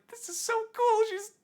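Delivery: high, thrilled squeal; giddy high-pitched awe; squealing delight. Retold or imitated. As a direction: gush like a starstruck kid. Gushing over the lady's gift.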